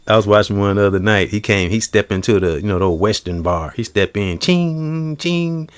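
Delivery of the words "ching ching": spur jingle sound. Retold or imitated.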